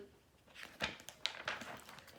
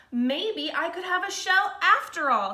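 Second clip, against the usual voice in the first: higher, louder bright idea voice; light up with a sudden idea; hopeful slug; 7 semitones higher, 4 dB louder than usual. Acted. Norman gets an idea.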